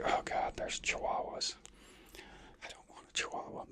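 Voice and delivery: husband's unspoken dread, whispered; whispered inner thought. Retold or imitated. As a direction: whisper it as the secret thought you won't say aloud.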